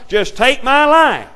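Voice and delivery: loud, high desperate plea to God; pleading cry. Acted. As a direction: cry it out like a man begging to die. Jonah calls upon God.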